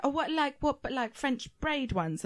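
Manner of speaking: confused keen stylist; puzzled hairdresser. Retold or imitated. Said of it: "And she was like".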